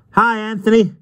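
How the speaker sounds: high, cheerful called-out greeting; happy call. A narrator's reading. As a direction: call out happily to a friend. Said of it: "called Miss Eels as she waved happily".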